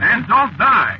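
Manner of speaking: high, loud alarmed plea; alarmed outburst. Acted. Driver begging his strange passenger, high and loud alarmed. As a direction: blurt it out in a panic.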